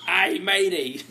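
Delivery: pirate voice, amused. Acted. Delivering a pirate joke, laughing pirate voice. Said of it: "What did the pirate say".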